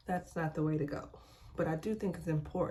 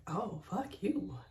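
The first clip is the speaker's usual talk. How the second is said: spoiled retort; 3 semitones higher, slower than usual. Retold or imitated. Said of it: "me being the spoiled one, I'm just like".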